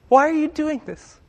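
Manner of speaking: high, harsh, snorted question; scoffing rebuke. Retold or imitated. Critics scoffing at the woman, a high harsh rebuke. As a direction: scoff at her like an angry horse snorting. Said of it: "angrily snorted at".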